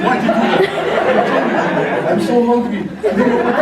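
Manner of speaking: high, loud, laughing mock outrage. Friend complains to roommate about his eaten sandwich, hammed-up outrage. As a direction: squeal it like a wronged hungry friend.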